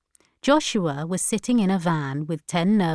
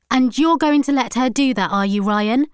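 higher, indignant challenge; angry questioning; 7 semitones higher, 6 dB louder than usual. A narrator's reading. Granddaughter confronting the man over grandmother's plan, angry. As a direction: challenge him crossly. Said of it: "asked Irie angrily".

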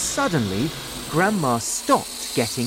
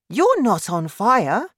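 put-on high, raised boy's voice; boy reassuring grandma; 8 semitones higher, 5 dB louder than usual. A narrator's reading. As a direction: insist reassuringly, high and loud.

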